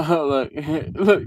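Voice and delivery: usual speaking voice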